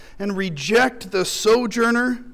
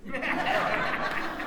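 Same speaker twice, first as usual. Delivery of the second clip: soft laugh; 11 dB quieter than usual. Real, not acted. Pastor laughs at own joke.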